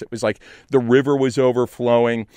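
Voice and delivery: ordinary talk, nothing marked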